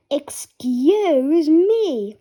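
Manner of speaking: slow, prissy apology; slow prim excuse me. Acted. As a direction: drag it out politely. Polite after a burp.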